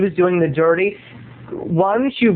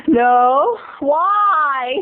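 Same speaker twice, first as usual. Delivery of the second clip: Laura impression; 8 semitones higher than usual. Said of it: "Laura's reply".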